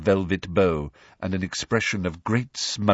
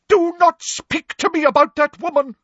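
loud, very high shriek of indignation; shrill, emphatic prohibition; outraged cry; 7 dB louder than usual. A narrator's reading. Professor Trelawney rebuking Parvati, outraged cry. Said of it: "cried Professor Trelawney".

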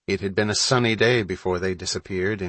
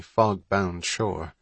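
ordinary delivery, nothing marked

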